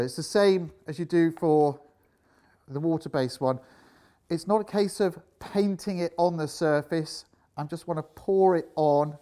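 talking through a dust mask; muffled speech behind a mask. Real, not acted. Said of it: "Sorry if it gets a bit muffled from here on in".

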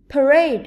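"Parade" with a loud stressed second syllable; weak start, strong RADE in "Parade"; teacher's demo of "Parade", beat on the last syllable. Shown as a demonstration. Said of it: "có trọng âm rơi vào âm tiết thứ hai".

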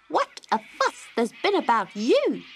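a busy little bird; chirpy wagtail. A narrator's reading. Willy Wagtail chats to Dot, high and chirpy. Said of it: "he said".

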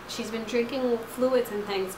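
ordinary talk

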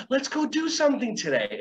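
high, upbeat invitation; teacher cheerfully redirecting a child. Acted. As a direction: brightly invite the kid to play. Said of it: "and then you go".

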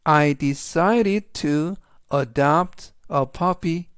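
slow, careful English sentence; slow model sentence. Shown as a demonstration. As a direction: read it slowly for learners. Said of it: "그래서 전체적인 의미는". (천천히 또박또박 읽는 예문)

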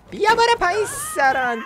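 very high squeaky mock voice, slowly; squeaky yell. Gamer squeals at Somayeh in the arena, high and slow.

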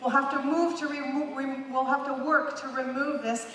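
ordinary talk

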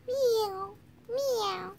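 little pretend kitten mewing; kitten meows. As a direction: mew like a tiny newborn kitten. Newborn kittens voiced.

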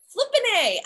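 high frustrated outburst. Real, not acted. Recalling her unused workout program, frustrated. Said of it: "what made me so angry is".

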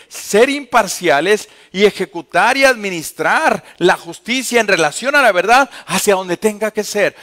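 loud, driving sermon cadence; preaching at full force. Acted. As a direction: preach it hard to the congregation. (predicando a todo pulmón)

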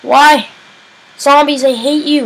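high, loud frustrated cry; real loud gamer frustration; angry outburst. Real, not acted. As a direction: shout your hatred at the zombies. Raging at zombies.

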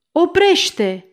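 high, loud cry to halt; shouted stop. A narrator's reading. The mayor shouts at the servant to halt, a loud high cry. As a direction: yell it out sharply to stop him. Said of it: "primarul strigă".

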